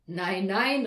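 loud, impatient refusal; exclaimed protest at full voice; calling out. A narrator's reading. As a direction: cut her off with a loud objection. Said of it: "rief der Nikolaus".

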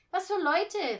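put-on high, loud child voice; brash kid's question. A narrator's reading. Boy asks the rehearsal group about inns, loudly curious.

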